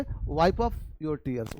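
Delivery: tears said like टीयर्स, very slowly; tears as teers. Shown as a demonstration. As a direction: drag it out so tears clearly rhymes with here. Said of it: "टीयर्स मत पढ़ देना". (tears को टीयर्स बोलिए, बहुत धीरे)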